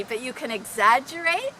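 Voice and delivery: ordinary talk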